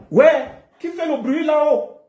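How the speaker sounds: sudden high, loud shout at a noisy neighbour; real annoyed shout toward the floor above; calling out upstairs. Real, not acted. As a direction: break off and yell up at whoever is making noise. Yells at noise from upstairs.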